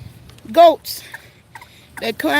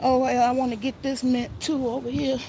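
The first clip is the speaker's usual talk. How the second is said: normal talking voice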